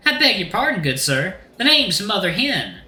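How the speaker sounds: put-on high-pitched folksy Southern accent; grand mother-hen Southern accent; put-on Southern-style accent. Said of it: "he's nailing this accent".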